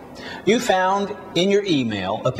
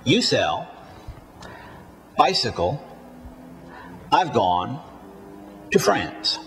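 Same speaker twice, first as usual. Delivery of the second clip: quick "You", "I've", "to", beats on "sell", "bicycle", "gone", "France"; stressed content words; 4 semitones lower, 4 dB quieter than usual. Shown as a demonstration. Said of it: "Once more".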